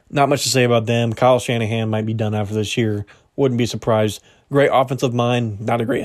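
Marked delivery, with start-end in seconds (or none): none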